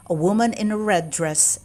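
plain reading voice

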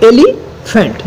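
Elephant broken as এলি, pause, ফ্যান্ট; split in two. Shown as a demonstration. Teacher dividing a word for students, elephant in two parts. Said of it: "দুটো ভাগে".

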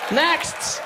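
high, loud summons; brusque check-in woman's shout; unfriendly receptionist. Acted. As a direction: call the queue curtly. Receptionist calls next patient.

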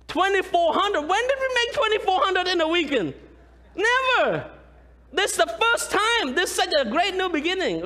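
high, thrilled proclaiming of the record; excited boast. Real, not acted. Pastor thrilled to tell the church of the record, excited. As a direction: announce it like a triumph.

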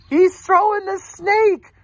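high, loud amazed announcement; excited exclamation. A narrator's reading. Reader repeats the big reveal to children, high loud amazement. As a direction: proclaim it high, stretching the words.

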